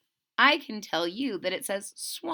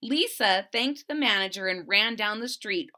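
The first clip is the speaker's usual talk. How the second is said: ordinary delivery, nothing marked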